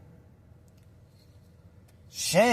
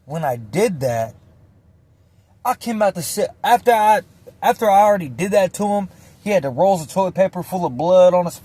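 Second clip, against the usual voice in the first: normal talking voice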